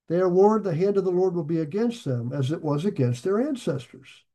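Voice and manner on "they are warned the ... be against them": normal talking voice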